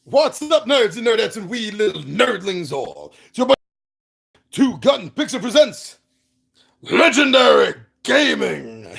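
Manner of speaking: high, bright show-host greeting; showman intro. Acted. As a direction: hype up the channel intro.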